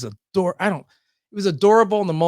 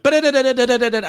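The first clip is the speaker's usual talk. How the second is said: fast, louder rattled-off patter; rapid radio patter; 5 semitones higher, 4 dB louder, faster than usual. Retold or imitated. Host mimics his rapid-fire on-air intro. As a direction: rattle it off like a rushed broadcast. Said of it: "I went".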